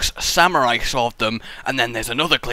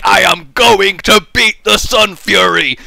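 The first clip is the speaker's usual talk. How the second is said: slow, higher, emphatic mock resolve; determined contestant; 5 semitones higher, 5 dB louder than usual. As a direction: voice the contestant's big vow, word by word. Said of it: "He is like".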